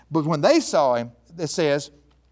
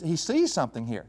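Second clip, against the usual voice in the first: usual speaking voice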